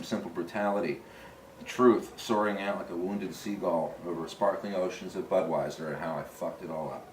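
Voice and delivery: slow, low, softening literary reading; book reading. Acted. Author reads the first paragraph of his book, slow hushed recital.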